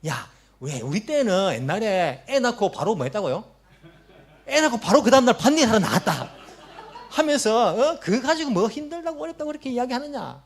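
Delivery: swelling to loud scorn, then fading to a curt brush-off; old-timer scolding. Retold or imitated. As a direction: imitate an elder scoffing at a young mother. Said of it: "그렇게 말하는 분".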